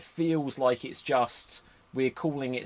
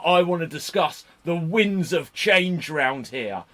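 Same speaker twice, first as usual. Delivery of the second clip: slow, louder imitation of a nasty British accent; Wade Barrett's nasty British accent; 3 semitones higher, 5 dB louder, slower than usual. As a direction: draw it out slowly in a mock-nasty British accent. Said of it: "his nasty British accent".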